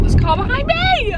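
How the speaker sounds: high, startled cry of alarm; real flustered panic at the wheel; sudden panic. Real, not acted. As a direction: blurt it out as a car looms up behind. Panicking at a car behind her.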